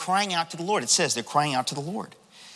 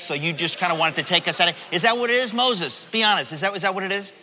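mock-polite, pestering heckler; sarcastic interrogation, hurrying through the middle; nasty sarcasm; faster than usual. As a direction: play an insistent questioner feigning an honest query. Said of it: "this is some nasty sarcasm".

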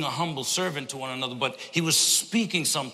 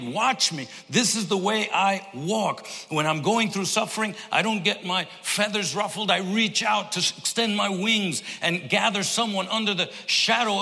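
preaching at full force; 5 semitones higher than usual. Acted. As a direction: preach it out to the back row. Full-force preaching, voicing Jesus.

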